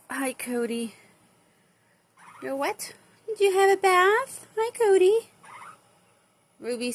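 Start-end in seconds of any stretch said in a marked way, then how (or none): 3.3-5.3 loud, high call to the birds; calling out to pet. Real, not acted. Owner calling her bird Cody, loud and high.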